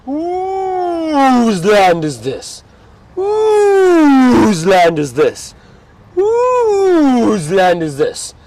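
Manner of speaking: high, very slow, drawn-out roaring call; lion's roar voice. Retold or imitated. Guide shows viewers what a roaring lion says, roaring slowly and high. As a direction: roar each word out like a lion claiming land. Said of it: "He's going".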